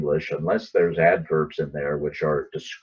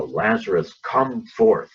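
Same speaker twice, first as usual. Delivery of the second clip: commanding call; 6 semitones higher, 4 dB louder, slower than usual. Retold or imitated. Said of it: "it was authoritative, it was commanding".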